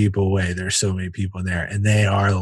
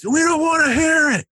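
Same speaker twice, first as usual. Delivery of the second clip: heckler's shout. Retold or imitated. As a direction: cut the comic off from the crowd. Audience cuts off his joke.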